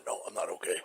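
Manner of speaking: low, soft pained groan. Retold or imitated. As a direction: moan it out like you're hurt. Hurt father after fall.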